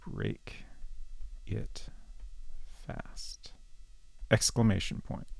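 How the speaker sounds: softly spelling out each word while typing, fading to a whisper; quiet word-by-word dictation. Real, not acted. Streamer types the spell into the game, softly whispered. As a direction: mutter it to yourself as you type it in.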